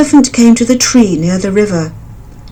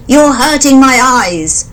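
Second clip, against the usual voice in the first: slow, higher, pained; hurt elephant; 6 semitones higher, 4 dB louder, slower than usual. A narrator's reading. Elephant in pain begging the birds, slow. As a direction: beg the birds to stop.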